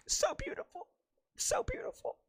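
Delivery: high, hushed mock-swoon whisper. Fan reacting to the leaked kiss, mock swooning. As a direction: whisper it in a tiny high voice, as if overcome.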